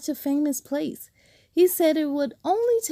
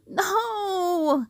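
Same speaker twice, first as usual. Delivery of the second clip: high whining no; crying childish no; babyish whine; 6 semitones higher than usual. Acted. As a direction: whine like a baby. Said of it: "he whined like a baby".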